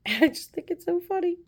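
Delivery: high-pitched, laughing through the words; amused laughing. Real, not acted. Wife tickled by husband falling every day, amused laughing. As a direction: let real giggles lift the voice up high.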